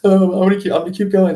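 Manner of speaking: put-on a hurt kid refusing to quit; mock little kid vowing to go on; determined kid. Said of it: "I'm like".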